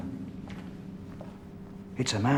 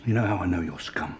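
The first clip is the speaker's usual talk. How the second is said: quiet menace; 5 semitones lower, 5 dB quieter than usual. Acted. Detective menacing the suspect.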